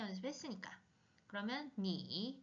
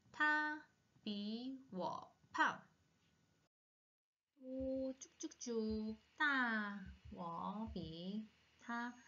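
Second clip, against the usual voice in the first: slow drill; much slower than usual. Shown as a demonstration. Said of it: "slow model reading".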